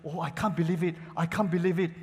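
usual speaking voice

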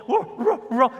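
high answering barks; quick high-pitched ruffs; imitated dog bark. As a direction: bark back like the neighbor's dog. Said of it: "the other neighborhood dog will go".

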